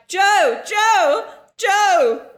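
loud, high hammed-up calling; mock moaning name. Pretend lover calling out, loud and high. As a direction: belt the name like mid-sex.

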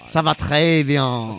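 slow put-on French accent. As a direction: say it slowly like a Frenchman. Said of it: "okay, but say it".

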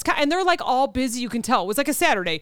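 normal talking voice

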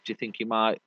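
ordinary talk

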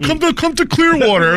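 high, loud hammed-up sales pitch; mock mayor pitch. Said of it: "He's like".

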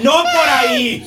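very high, drawn-out comic wail; mock disoriented neighbour. Disoriented neighbour calling out in bed, long high mock wail. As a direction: stretch out a squealed imitation. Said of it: "la vecina desorientada".

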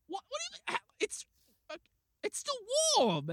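very high stammering exasperation; mock speechless detective; flustered disbelief. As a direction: sputter it in a high voice. Flustered detective sputters at officer.

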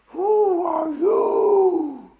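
high, loud, drawn-out dragon voice; girlish dragon. Acted. Dragon challenges the intruders, high drawn-out girlish boom. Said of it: "You sound like a girl".

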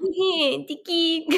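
high, light tick of the watch; watch sound. Pretend. Player voicing the magic watch, high little tick. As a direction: chirp it like a tiny clock. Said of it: "the watch is like".

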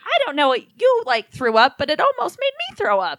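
high grossed-out mom voice. Retold or imitated. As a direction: sound half disgusted. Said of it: "she was like".

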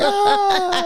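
very high mock squeal; high playful laughing squeak. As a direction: shriek like a teakettle. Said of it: "I'm like".

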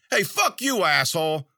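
high-pitched heckler's yell. A narrator's reading. Someone in the crowd heckling the speaker, yelling. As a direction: yell an insult up at the stage. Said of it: "Someone in the crowd yelled".

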